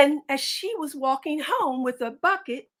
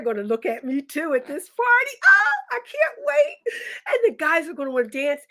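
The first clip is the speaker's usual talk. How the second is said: high, loud gleeful squeal; squealing excitement; 6 semitones higher, 3 dB louder than usual. Acted. Milkmaid thrilled about the boys at the party, shrieking with glee. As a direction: burst out with giddy delight.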